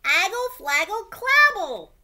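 put-on high, loud baby babbling; toddler babble. Trixie babbles urgently at her daddy, high squeal. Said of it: "said Trixie again".

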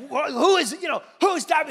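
half-asleep loud startled question; startled demand. Retold or imitated. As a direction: call out, freshly woken and alarmed. Retells waking in alarm.

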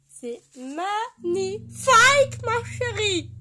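drawn-out delighted gush. Real, not acted. Admiring her drawing in front of viewers, gushing.

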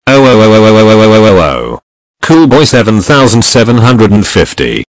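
very loud mock tirade; mad outburst. Raging at Coolboy over his video, very loud mad outburst. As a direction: blast out stuttered ohs, then a booming scolding. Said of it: "I got so mad at Coolboy".

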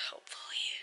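fading whispered repeat, very soft; hushed whisper. Real, not acted. Vlogger by the sleepy baby, whispering to herself. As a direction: breathe it out under the breath.

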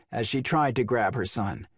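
ordinary delivery

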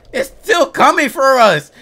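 loud high alarmed call; panicked shout. Real, not acted. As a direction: shout the warning in fear. Monster pursuing.

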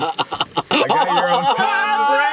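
high, slow, laughing reveal; excited laughing. Real, not acted. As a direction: laugh out the big surprise.

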